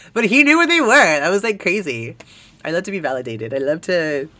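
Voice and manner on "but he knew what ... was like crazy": high, loud burst easing down; amazed excitement. Real, not acted. Streamer telling of a boy who knew the posters, real excitement. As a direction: blurt it out, genuinely thrilled. Amazed he shared her niche interest.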